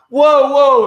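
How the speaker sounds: high, loud startled cry, acted out; retold yelp of alarm; alarmed shout. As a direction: shout as if halting someone with a gun. Retold panic as guns come out.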